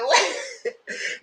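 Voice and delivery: high, breathy laugh fit; giddy laugh; giddy laughter. Real, not acted. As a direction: laugh it off after shouting. Laughing after outburst.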